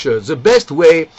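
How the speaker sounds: ordinary talk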